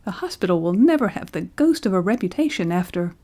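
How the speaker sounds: ordinary delivery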